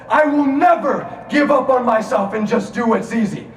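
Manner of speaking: loud, high, emphatic declaration; shouted vow. Shown as a demonstration. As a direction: hammer each word of the promise. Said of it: "then I said this".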